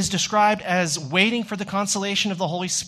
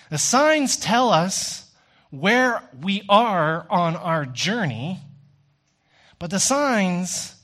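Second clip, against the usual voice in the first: reflective preaching; 4 semitones lower than usual. Acted. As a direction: let each phrase land slowly. Slow reflective sermon passage.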